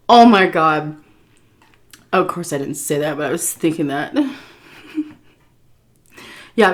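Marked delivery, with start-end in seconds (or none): none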